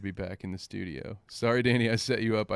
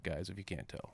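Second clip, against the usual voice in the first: normal talking voice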